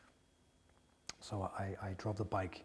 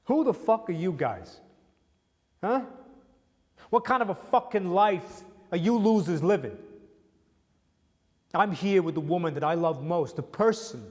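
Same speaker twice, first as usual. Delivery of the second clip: loud, raised, high-pitched outburst at the rich drivers; defiant shout; 5 semitones higher, 7 dB louder than usual. Retold or imitated. Man on the highway yelling at sports car drivers, fierce shout. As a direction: yell it out, proud and grieving at once. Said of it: "I'm looking at them like".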